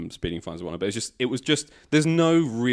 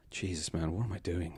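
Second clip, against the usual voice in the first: soft, muttered to himself; weary self-talk; 9 dB quieter than usual. Retold or imitated. Retold dejected self-talk on low pay.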